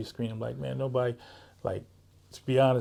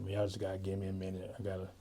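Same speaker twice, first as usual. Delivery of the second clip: low-key, quieter retold appeal; subdued plea; 3 semitones lower, 8 dB quieter, faster than usual. Mentor retelling bad days to juvenile youth, subdued plea. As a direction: softly imitate asking for a little patience.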